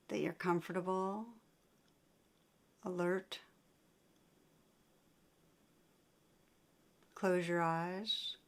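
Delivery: unhurried meditation guidance with long pauses; guided meditation. Real, not acted. Teacher guiding listeners to sense painful emotions, guided meditation. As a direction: lead them inward, leaving long silences between phrases.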